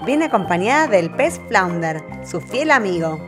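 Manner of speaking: ordinary delivery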